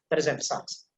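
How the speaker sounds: ordinary delivery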